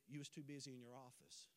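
low, hushed reported reply; soft child's answer. Retold or imitated. Daughter explaining to her daddy why he's left out, soft answer. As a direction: let it sink, very quiet and low.

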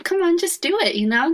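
high, coaxing push to go for it; pushy encouragement. Retold or imitated. Friend urging her to act.

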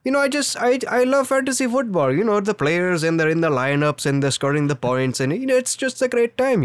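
chatty call-centre patter in an Indian accent. Shown as a demonstration. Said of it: "My Indian accent is my favorite accent".

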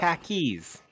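mock Boston accent on "Khakis", r slipped in; Yankee accent. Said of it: "you sound like a Yank".

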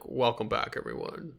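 a calm, low welcome fading to a whisper; soft soothing welcome; deep soothing voice. Shown as a demonstration. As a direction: soothe the listeners in. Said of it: "a deep, soothing voice".

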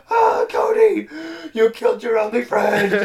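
high, loud anguished wail; mock agony. Melting friend accusing Cody, mock agonised wail. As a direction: cry out in hammed-up pain. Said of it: "he's in horrible, horrible pain".

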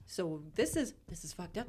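ordinary talk, nothing marked

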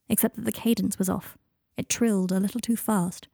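ordinary delivery

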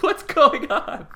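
high, slow, stunned; baffled. Retold or imitated. Caller confused by the old promoter, baffled. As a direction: drag it out in disbelief.

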